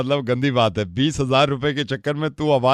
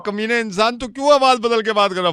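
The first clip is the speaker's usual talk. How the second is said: raised, higher mock scolding; mock outrage; 6 semitones higher than usual. Prank caller scolding friend for voice change, mock outrage. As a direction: play it as fake outrage.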